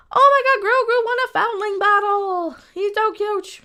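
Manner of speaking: high, loud cooing fangirl; gushing fan. Retold or imitated. Parodying gushing fans.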